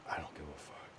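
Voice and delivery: breathy whisper of not caring; whispered retort. Retold or imitated. To remorseful people, a dismissive whisper. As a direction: whisper it like a shrug of contempt. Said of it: "I'm like".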